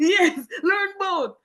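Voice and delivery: high, slow, drawn-out mock teacher; laughing echo. Retelling the teacher's advice to a friend, high, slow and amused. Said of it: "repeating teacher".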